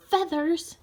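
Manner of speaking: plain reading voice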